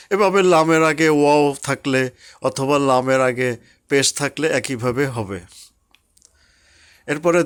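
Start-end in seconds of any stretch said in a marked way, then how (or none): none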